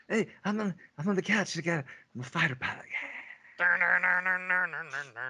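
high-pitched, hammed-up celebrity impersonation; deliberately bad, high star impression; terrible Tom Cruise. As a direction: play a badly faked movie star, pitched well up. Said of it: "That was my terrible Tom Cruise impersonation".

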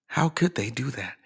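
hushed, mock-hurt musing; a quiet, playful pout of disbelief; mock wounded. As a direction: softly play at being baffled. Mock self-pity at the mirror.

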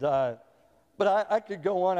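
ordinary talk

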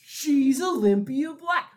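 high, loud and slow; astonished realization. Acted. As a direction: draw it out as a stunned revelation.

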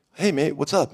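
a casual New Zealand greeting, lightly done; Kiwi accent. Retold or imitated. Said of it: "like, this Kiwi".

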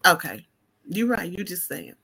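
ordinary delivery

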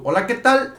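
mock his trademark Hola, ¿qué tal?; imitating his greeting. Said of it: "Es tu distintivo". (imitando su saludo característico, más agudo)

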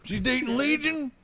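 high surprised character voice; put-on voice. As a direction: squeak the question in character. S1 holds put-on voice.

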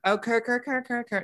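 "cur, cur, cur" repeated high with no trill; failed rolled R. Pretend. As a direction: stutter "cur" in a high voice, R left unrolled.

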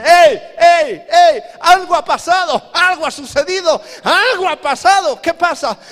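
high, full-force frantic shouting; Satanás in alarm. Acted. Devil warning his demons that power has descended, frantic shouting. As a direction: yell a warning over a noisy party. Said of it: "Satanás dice".

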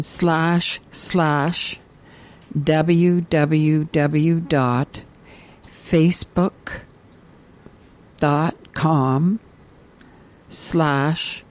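slow, with long gaps between pieces; very slow dictation. Real, not acted. Radio host repeating the site address for listeners, very slowly. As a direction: leave time to write each part. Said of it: "gonna say this very slowly".

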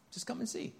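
just offer it quietly; gentle invitation. Retold or imitated. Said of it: "He simply says".

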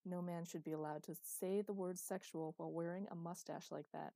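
plain reading voice

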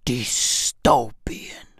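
low slow whispered word; ominous whisper; slow spooky whisper. Acted. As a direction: draw it out in an eerie whisper. Dramatic whisper.

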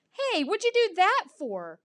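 high-pitched protest; high, wounded indignation; indignant bark. A narrator's reading. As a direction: object like an affronted pup. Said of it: "I barked".

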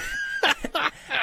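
high burst of laughter. Real, not acted. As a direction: break into a laugh. Laughing at own story.

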